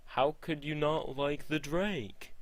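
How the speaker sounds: stupid high, drawn-out voice; Jerry Seinfeld. Acted. Jerry asking George about the Drake, silly high voice. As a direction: put on a silly rip-off B-movie voice. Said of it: "do a stupid voice for Jerry Seinfeld".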